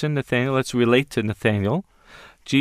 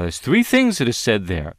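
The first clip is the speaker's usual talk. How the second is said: ordinary delivery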